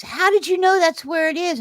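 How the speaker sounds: high, stunned "how did you know"; mock shock, voice jumping up high; shocked question. Owner shocked the vet knows.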